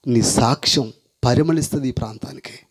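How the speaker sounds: ordinary delivery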